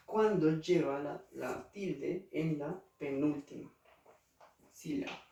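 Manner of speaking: usual speaking voice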